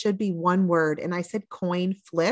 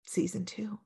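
whispered teasing aside; soft conspiratorial whisper; 10 dB quieter than usual. Real, not acted. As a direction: breathe it like a secret. Teasing hint at a sequel.

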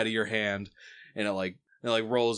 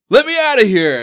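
very high, loud plea; panicked yell; 13 semitones higher, 17 dB louder than usual. Acted. Crewman wrapped in chain by Owlbear, panicked yell. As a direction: shout to be freed.

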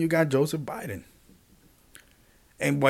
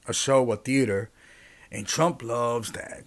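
normal talking voice

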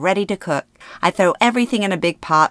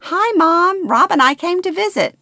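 much higher, louder sing-song greeting; bright cheer; 13 semitones higher, 5 dB louder than usual. Acted. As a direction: greet sweetly as if all is normal. Sister's bright cover greeting.

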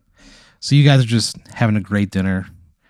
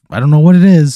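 gushing dinner date; 6 semitones higher, 10 dB louder than usual. Acted. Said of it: "She goes".

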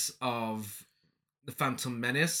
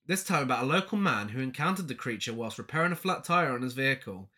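ordinary delivery

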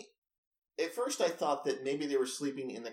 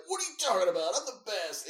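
mock-indignant, louder and higher boast; indignant retort; 7 semitones higher, 6 dB louder than usual. Businessman brushing off a kid at the arcade, indignant. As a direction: act out a cocky grown gamer snapping back.

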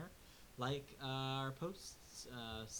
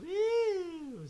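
high loud whoop; excited cheer; 11 dB louder than usual. Real, not acted. Cheers twentieth episode.

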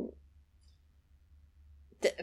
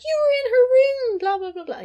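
high, loud, half-crying mock rant; mock accusing Luke; 7 dB louder than usual. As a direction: squeal the accusation in a hammed-up voice. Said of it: "And he's like".

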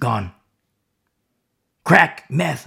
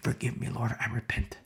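hushed prayer; 10 dB quieter than usual. Real, not acted. Preacher prays quietly.

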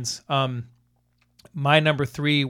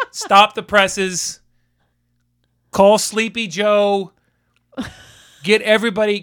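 slow, loud mock headline call; barking newspaper editor; 4 semitones higher, 6 dB louder, slower than usual. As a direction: bellow it like a newsroom boss. Mock newsroom announcement.

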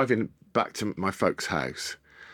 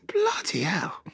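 hushed, high gasp of amazement; stunned whisper; 9 semitones higher, slower than usual. Retold or imitated. Son floored by Gordon's answer, hushed gasp. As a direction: breathe it out in disbelief.

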